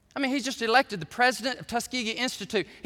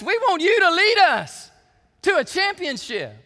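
much higher, louder, eager cafeteria workers cheering him on; excited fans; 11 semitones higher, 10 dB louder than usual. Retold or imitated. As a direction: pump him up, hoping for the title. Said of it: "they're like".

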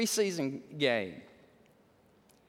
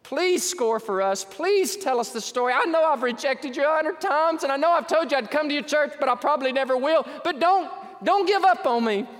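impassioned plea, building higher and louder; crying out; 7 semitones higher, 5 dB louder than usual. Acted. Preacher voices lost people begging the church, crying out. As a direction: beg urgently, rising to a loud cry. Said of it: "they're crying out to me".

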